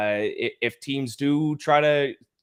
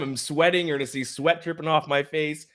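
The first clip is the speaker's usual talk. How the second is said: ordinary delivery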